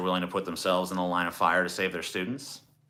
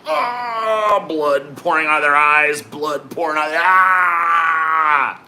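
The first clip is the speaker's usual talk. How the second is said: hammed-up shouting, much higher, crying out; mock scream; 6 semitones higher, 8 dB louder, much slower than usual. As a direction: scream like a cartoon victim whose head explodes. Kids' minds explode, mock screaming.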